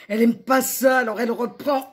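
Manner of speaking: high, loud, insistent telling; agitated narration. A narrator's reading. Narrator on La L starting over, agitated. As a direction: rush it out irritably.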